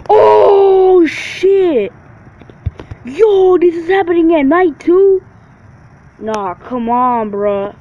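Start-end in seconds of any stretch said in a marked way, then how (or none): 0.1-1.9 excited shout. Real, not acted. Wrestling game hype reaction. 3.0-5.2 surprised shout. Real, not acted. Reacting to game surprise.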